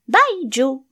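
loud, high shout to the closing door; sudden loud farewell shout; sudden call. Acted. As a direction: call out suddenly after him. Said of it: "cria soudain".

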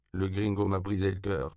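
ordinary delivery

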